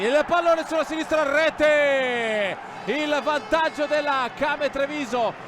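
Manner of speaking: excited goal call, loud and high; breathless italian goal commentary. Real, not acted. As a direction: shout the goal like a live commentator. Goal call, live play-by-play.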